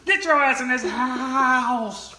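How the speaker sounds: slow, loud, high mock yell; mock scolding. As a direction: call them in like a scolding mom. Joking scolding call-out.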